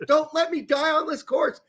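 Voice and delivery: imitated mom's overwrought complaint; mock pleading. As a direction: plead in an exaggerated high voice. Imitates impatient mom pleading on course.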